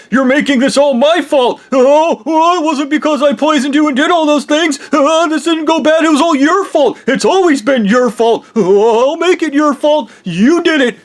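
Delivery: high, loud ranting blame, voicing Blondie; mock angry rant. Blondie blaming the speaker, a loud high mock rant. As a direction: shout the blame back at him in a put-on voice.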